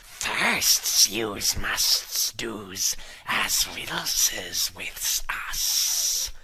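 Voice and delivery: low, slow, whispery Gollum hiss. Acted. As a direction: creep and hiss over the precious. Gollum demands riddles.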